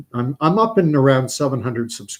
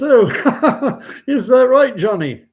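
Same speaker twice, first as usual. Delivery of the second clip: high, loud laughing reply from a dad; put-on chuckle; 12 semitones higher, 9 dB louder, slower than usual. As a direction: laugh it off in a British accent. Father laughing at Johnny before vicar.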